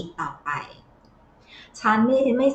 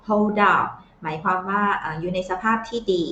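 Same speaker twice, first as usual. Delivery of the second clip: usual speaking voice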